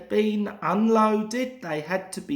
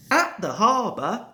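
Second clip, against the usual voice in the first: ordinary delivery, nothing marked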